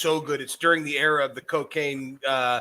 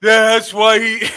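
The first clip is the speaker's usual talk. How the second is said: mock wrestler promo; 4 semitones higher, 8 dB louder, slower than usual. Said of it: "It needs to be".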